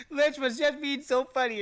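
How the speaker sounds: loud laughing complaint. Real, not acted. Laughing at the friend's reading. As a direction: laugh the words out.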